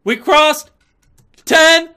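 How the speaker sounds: very high, very loud scream; real milestone celebration; victory scream. Real, not acted. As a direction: yell the milestone. Celebrating crossing ten thousand.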